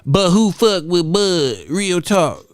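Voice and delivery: exaggerated dopey read of broken English; mocking imitation. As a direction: drag the garbled sentence out to mock it. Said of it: "half the shit he's saying".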